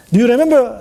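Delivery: usual speaking voice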